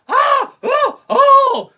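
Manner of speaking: high screaming yelps; mock nightmare screams. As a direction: shriek like she's in a nightmare. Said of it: "she's screaming like a".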